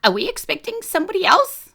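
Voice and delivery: high, squeaked question; squeaky. Acted. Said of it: "I definitely squeak".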